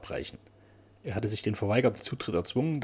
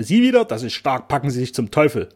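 furious keeper's yell, higher and louder; angry; 3 semitones higher, 10 dB louder than usual. Acted. Keeper shouting the painter away from the lighthouse, furious. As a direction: bark him out the door in a rage. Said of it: "mit ärgerlichster Stimme".

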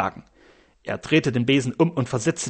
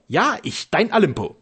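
proud, raised pitch on the opening; 6 semitones higher than usual. A narrator's reading. Husband boasting to his astonished wife, proud. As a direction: declare yourself with a touch of pride. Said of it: "antwortete er stolz".

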